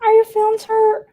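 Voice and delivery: put-on teasing husband in baby voice; mock baby talk. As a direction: coo it like to a sulking toddler. Teasing husband after pizza fight.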